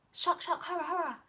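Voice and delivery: hammed-up gasp, high and airy; exaggerated pretend horror, high-pitched; mock shock. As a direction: play at being scandalised. Mock reaction to doing another one.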